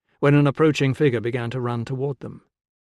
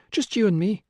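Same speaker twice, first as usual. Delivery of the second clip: gentle coaxing; 8 semitones higher than usual. A narrator's reading. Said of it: "she suggested".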